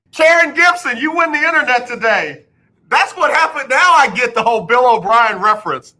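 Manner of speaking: delighted. Real, not acted. Delighted by a text.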